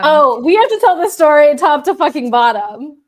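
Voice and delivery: loud, eager interjection; excited outburst. Real, not acted. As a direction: burst in loudly, dying to tell the story. Eagerly demands the whole story.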